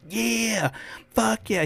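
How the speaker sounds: a hyped cheer thrown out mid-practice, high and amped; hyped aggressive teammate. Retold or imitated. Wild teammate fired up at practice, high mock cheer. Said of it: "he was so aggressive".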